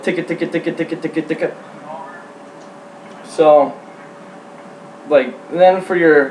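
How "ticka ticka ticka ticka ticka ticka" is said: quick, soft "ticka, ticka" run; fast feeder-call ticking. Shown as a demonstration. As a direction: rattle off "ticka" like a feeding duck. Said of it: "you can say it a lot faster and go like".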